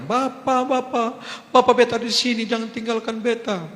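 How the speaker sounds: high-pitched frantic plea; pleading woman. Retold or imitated. Injured woman begs the pastor not to leave, high pleading voice. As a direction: beg like an injured woman clinging on. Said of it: "yang perempuan berkata".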